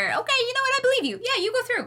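high, sing-song mock guard voice; mock gullible guard. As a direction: play a naive guard, squeaking with easy trust. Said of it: "and he's like".

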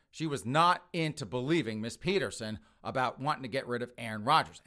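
ordinary talk, nothing marked

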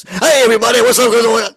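very loud, high, distorted greeting; loud, clipping shout. Shown as a demonstration. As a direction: shout it so the mic clips. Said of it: "that means I don't have to worry about doing this".

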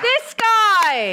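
high, loud drawn-out cheer; big up your co-host at full volume; hype shout. Pretend. Co-host hyping the host's opening line, high shout.

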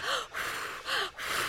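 heavy, exaggerated breaths; breathing exercise. Woman showing how she does her breathing exercises, exaggerated breaths. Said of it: "I try to do the breathing stuff".

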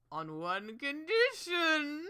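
slow, sly villain bargain; a menacing villain's deal; evil king voice. Retold or imitated. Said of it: "Winterbolt's like".

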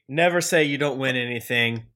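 ordinary talk, nothing marked

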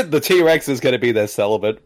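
laughing through the words; giggling while talking; cracking up mid-line. Real, not acted. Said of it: "I'm laughing at my own jokes again".

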